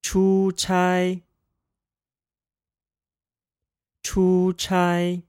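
出差 said chū chāi, two high level tones; 出差 with a first tone on both syllables; practicing tones. Shown as a demonstration. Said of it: "first tone pair".

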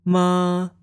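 MA held high and level. Shown as a demonstration. Teacher demonstrates Chinese sounds, high level tone. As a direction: say MA high, steady and drawn out. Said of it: "Now the Chinese sounds".

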